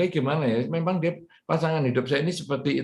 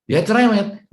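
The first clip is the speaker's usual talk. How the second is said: exasperated complaint; 8 semitones higher, 6 dB louder than usual. Shown as a demonstration. Complaint set against acceptance.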